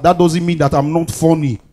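usual speaking voice

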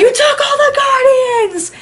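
high, slow, anguished yell; theater scream. Retold or imitated. Fan in the theater screaming at the screen, high and slow. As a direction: scream it at the screen like the whole crowd. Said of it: "Screaming in the theater".

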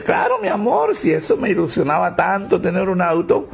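voz aguda y melosa de señora; esposa dulzona. Acted. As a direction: finge la dulzura de la esposa. (esposa melosa con voz aguda)

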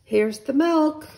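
high, loud happy shout; excited shout. Acted. Boy shouting to his mother about the milk. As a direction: call out the milk proudly. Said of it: "shouted Jack".

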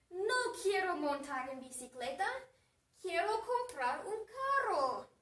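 hammed-up griping with stretched endings; acted whining complaint. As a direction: play a fed-up teenager griping about aches. Acting Samantha's sore complaint.

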